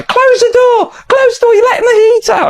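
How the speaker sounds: high, loud, shrieking mum telling him off; exasperated mum shouting about the heat; mum yelling. Retold or imitated. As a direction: bellow it like an annoyed mother at the door. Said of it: "First thing my mum would say".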